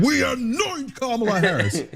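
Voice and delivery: high, laughing pompous proclamation; mock party decree. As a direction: announce it like a royal decree. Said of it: "the Democratic Party said".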